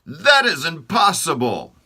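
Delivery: the king refusing to believe it; outraged king. Acted.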